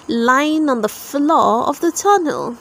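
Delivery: plain reading voice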